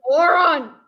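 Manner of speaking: loud frustrated outburst; exasperated shout. Real, not acted. Listener yelling at a foolish general, exasperated shout. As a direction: yell it at the character.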